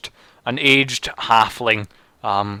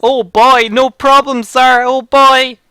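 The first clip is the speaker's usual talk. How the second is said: put-on high, loud, eager little boy; excited boy voice; 14 semitones higher, 10 dB louder, slower than usual. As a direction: squeal it like a thrilled kid. GM plays excited monk boy.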